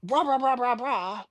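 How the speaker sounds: high, loud rah rah nonsense; mock yelling gibberish. Will yelling at the homeless guy, high mock rant. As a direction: yell it like drunk Will ranting. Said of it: "I think he was saying".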